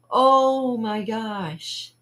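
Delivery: re-enacted shock, very slow; stunned reaction. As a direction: stretch it out in acted disbelief. Child reacting to the prank.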